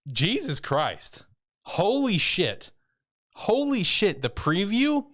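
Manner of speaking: high, slow, drawn-out exclamations; overwhelmed by what he saw; disbelieving holy shit; stunned excitement. Real, not acted. Reacting to the shocking preview.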